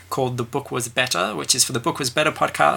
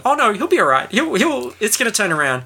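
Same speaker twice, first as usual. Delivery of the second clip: higher-pitched voicing of a hopeful viewer; hopeful reassurance; 9 semitones higher than usual. Voicing a viewer who thinks the fighter will recover, hopeful. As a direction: pitch up, talking yourself into hope. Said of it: "I think you're thinking".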